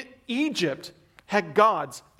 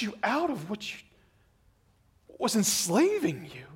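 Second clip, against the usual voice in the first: with compassion; 2 semitones higher than usual. Shown as a demonstration. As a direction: speak it like a loving father pleading. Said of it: "God is speaking with compassion".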